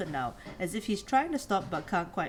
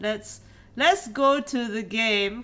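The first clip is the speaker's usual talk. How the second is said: normal talking voice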